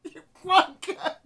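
high laughing yells; hysterical swearing. Real, not acted. Host swearing at the turtle blunder, high hysterical yells. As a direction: shriek the swears half-laughing.

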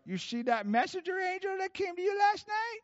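very high Arkansas squawk; Bob Jones. Retold or imitated. Prophet quizzing caller about the angel, high Arkansas accent. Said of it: "he's a little Southern boy at Arkansas".

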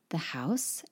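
the house whispered softly; whispered aside. Real, not acted. Teacher whispering the English meaning to learners. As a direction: breathe the translation as an aside.

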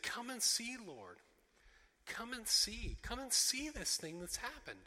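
pleading. Acted. Grieving disciples to Jesus, pleading.

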